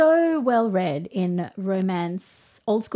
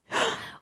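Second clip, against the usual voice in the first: sharp gasp; 14 semitones higher, 8 dB quieter than usual. Real, not acted. Reacts to friend's confession.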